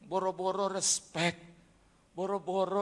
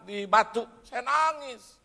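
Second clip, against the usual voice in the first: normal talking voice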